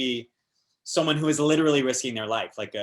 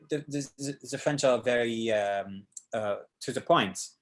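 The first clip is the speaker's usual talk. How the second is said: ordinary talk, nothing marked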